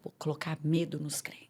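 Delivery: low whispered confession of a plan; sussurro conspiratório. Retold or imitated. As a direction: whisper it like a secret scheme. Confidencia a intenção intimidadora. (sussurro de quem confidencia um plano)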